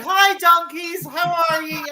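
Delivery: high, friendly shout to animals; shouted greeting. Shown as a demonstration. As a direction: holler hello across a field. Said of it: "I shout".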